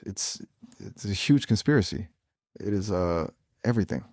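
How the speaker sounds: ordinary talk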